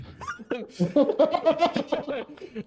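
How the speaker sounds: high, loud burst of laughter; big laugh. Acted. Dragonborn sees a big robot, loud laughter.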